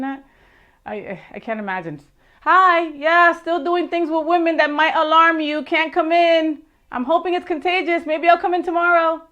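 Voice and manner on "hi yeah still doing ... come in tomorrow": higher, louder mock phone call; lightly voiced pretend call to the boss; mock sick call. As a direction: play up the fake excuse, a bit raised. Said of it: "I do really bad impersonations".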